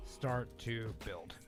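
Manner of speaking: ordinary talk, nothing marked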